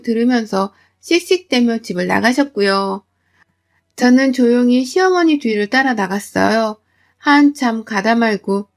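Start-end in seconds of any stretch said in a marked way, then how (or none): none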